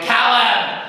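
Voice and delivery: loud shouting mum; exasperated mother's shout; mum yelling. Retold or imitated. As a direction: yell after the kid on the bike. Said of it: "and his mum went".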